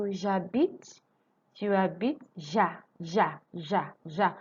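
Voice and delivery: J'habite said ja-bite with no H; silent H. Shown as a demonstration. Teacher shows students the silent H. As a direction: stress the ja sound in J'habite. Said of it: "So the H is silent".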